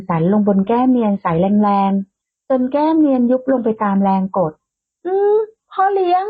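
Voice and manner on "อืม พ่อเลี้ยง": startled squeal. A narrator's reading. Nose pressed into his cheek.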